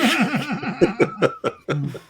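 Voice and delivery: sudden high burst of laughter. Real, not acted. Laughing at a silly character name, bursting into a high laugh.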